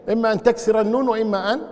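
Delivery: ordinary talk, nothing marked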